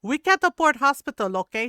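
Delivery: high, loud mother imitation; hammed-up stern mom voice; scolding mom. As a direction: warn the kids like a fed-up mom, high and loud. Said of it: "she would be like".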